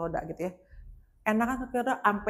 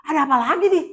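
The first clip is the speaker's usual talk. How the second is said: loud, raised-pitch retold outburst; alarmed exclamation; 6 semitones higher, 11 dB louder, faster than usual. Speaker hearing trouble near the lift, exclaiming loudly. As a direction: burst out in exasperated alarm.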